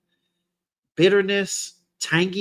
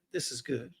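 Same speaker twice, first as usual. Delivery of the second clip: much softer "This is good." dropped to a murmur; quiet aside; 4 semitones lower, 14 dB quieter than usual. Real, not acted. Reviewer repeats his verdict quietly to himself, soft aside. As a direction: say "This is good" again under your breath. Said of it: "'Cause this is good".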